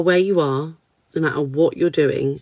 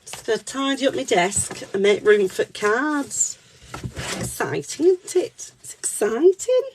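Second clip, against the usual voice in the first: high, squeaky cartoon cat voice; Candy Cat; 7 semitones higher than usual. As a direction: chirp it like a children's cartoon character. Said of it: "I do an accidental impression of Candy Cat".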